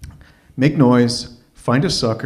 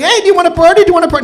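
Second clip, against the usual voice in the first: very high, loud squealing party voice; mock the women squealing for a party; pushy party women; 11 dB louder, much faster than usual. Women pressing him in the cab, squealed very high and loud. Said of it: "and they're saying".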